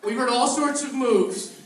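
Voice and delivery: loud, high, slow mock-outraged; loud disbelief. Real, not acted. Host mock-outraged, laughing.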